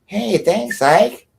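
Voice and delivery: high, singsong fake thanks; put-on high grateful voice; mock-grateful imitation. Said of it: "Does she say".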